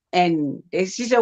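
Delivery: normal talking voice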